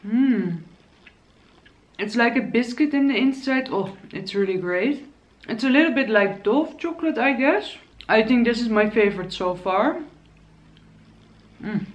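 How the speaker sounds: talking between bites; chewing. Real, not acted. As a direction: mouth full of chocolate. Seen 1: Bites chocolate, chews with closed lips, talks between bites.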